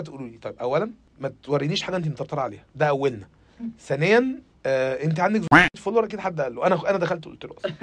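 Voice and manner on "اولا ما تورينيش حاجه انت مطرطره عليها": usual speaking voice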